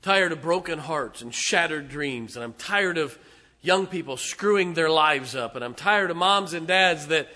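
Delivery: rising from firm to loud preaching; building sermon cry. Real, not acted. Preacher listing what he is tired of, building to a shout.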